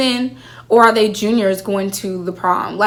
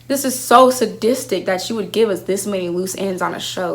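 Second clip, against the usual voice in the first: ordinary talk, nothing marked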